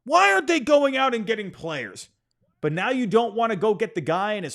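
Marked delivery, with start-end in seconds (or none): 0.0-2.1 mock fan complaint. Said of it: "they go out there saying".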